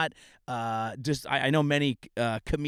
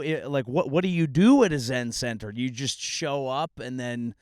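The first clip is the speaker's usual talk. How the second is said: ordinary talk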